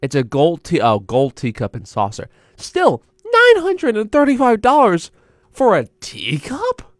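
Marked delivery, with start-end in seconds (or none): 2.6-6.9 incredulous. Real, not acted. Outraged at the teacup price.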